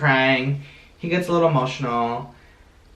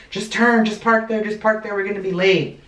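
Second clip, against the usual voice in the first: urgent retold nagging; 7 semitones higher than usual. Telling partner where to park before the concert, high and urgent. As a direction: hurry him into parking, pitched up and pushy.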